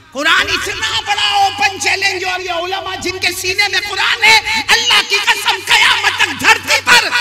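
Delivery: high, ringing sermon proclamation; full-force preaching. Real, not acted. (क़सम खाती बुलंद तक़रीर)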